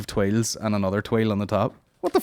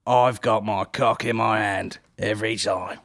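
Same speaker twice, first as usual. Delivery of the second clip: slow, relishing mock judge; lightly hammed-up food-critic drawl; Greg Wallace impression; slower than usual. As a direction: drag it out like a greedy critic. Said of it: "And he's like".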